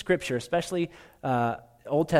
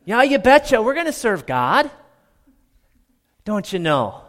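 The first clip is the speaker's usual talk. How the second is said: hammed-up Minnesota accent, higher and louder; 6 semitones higher, 6 dB louder than usual. The people promising Joshua, broad Minnesota accent. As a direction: lay on the Minnesota folksiness. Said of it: "as the northerners say ... Minnesota".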